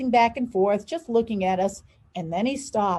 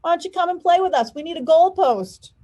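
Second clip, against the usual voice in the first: taunting yell; 6 semitones higher, 5 dB louder than usual. Acted. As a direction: jeer at her across the yard. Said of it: "Huey yelled out".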